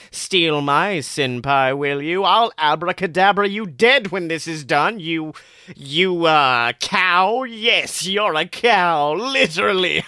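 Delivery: hammed-up threats at a cow; villain threat. A narrator's reading.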